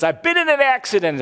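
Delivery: high, loud, alarmed phone blurt; imitating a daughter in a panic on the phone; panicked daughter. Daughter calling dad after a crash, mock panicked.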